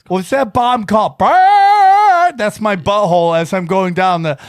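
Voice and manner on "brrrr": high loud buzzing fart siren; fart siren noise. Shown as a demonstration. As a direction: blare like an air raid siren. Imitating bomb siren again.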